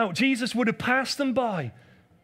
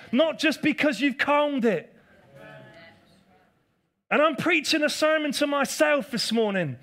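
raised, fervent preaching voice; 5 semitones higher than usual. Acted. Preacher rallying the church to worship before the calm, fervent. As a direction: build it up like a revival sermon.

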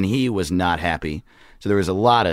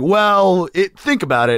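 higher, louder imitation of a persuader; retold recruiter; 9 semitones higher, 5 dB louder than usual. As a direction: imitate someone urging reconsideration. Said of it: "he was like".

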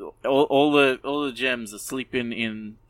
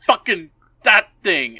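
higher, louder yell at the puppy; mock outburst; 7 semitones higher, 8 dB louder than usual. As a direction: shout it, fuming. Bort spots the puppy, outburst.

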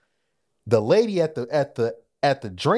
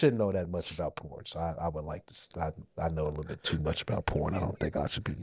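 drops to a low, soft murmur to cover up; hushed low voice; 9 semitones lower, 10 dB quieter than usual. Real, not acted. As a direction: talk low and soft like you're hiding it. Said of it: "Why'd your voice change?".

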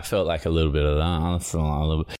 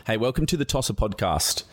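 usual speaking voice

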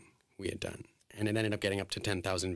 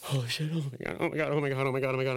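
higher, tumbling run of exclamations; frantic realization; 5 semitones higher, much faster than usual. Retold or imitated. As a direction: re-enact a racing, overwhelmed mind. Retold sudden realization, repeating in panic.

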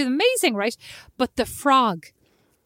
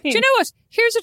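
sudden idea; 10 semitones higher, 5 dB louder, much faster than usual. Real, not acted.

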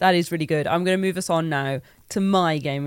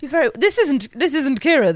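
ordinary talk

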